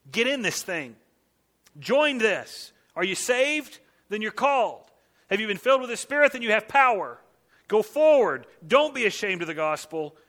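loud, high rallying cry; rally the church to go forward; preaching at full force. Acted. Preacher urging the congregation to go forward, loud and fervent.

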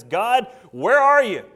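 loud, drawn-out mock plea to heaven; crying out. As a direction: cry out to God as a stranded man. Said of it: "he cries out and says".

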